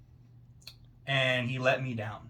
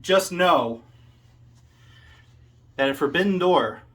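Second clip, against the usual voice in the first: loud call-out; 2 semitones higher, 5 dB louder than usual. Acted. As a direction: build to a shouted threat at a rival. Promo climax calling out Forrest.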